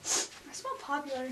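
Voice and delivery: breathy whispered retort; whispered comeback. Acted. Nerd whispering a comeback at the mean girl. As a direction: hiss it back under your breath. Repeated skit take.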